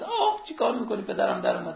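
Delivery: high, yelping cry of pain, played for laughs; mock yelp of pain. Preacher retelling the tattoo story, the wrestler yelping in mock pain. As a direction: yelp like a wrestler hit by a tattoo needle, half in jest. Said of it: "جناب پهلوان یهو جیغش در اومد، داد زد".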